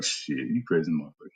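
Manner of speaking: muttered retort. Retold or imitated. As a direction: mutter it under your breath as a mock refusal. Acting out reply to stretch request.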